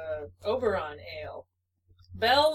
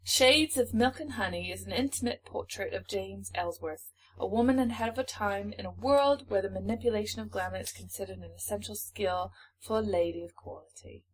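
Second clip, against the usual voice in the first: measured reading in a put-on British accent. Shown as a demonstration. Co-host reading book blurb to partner, British accent. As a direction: read the blurb out in British English. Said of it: "read this in a British accent".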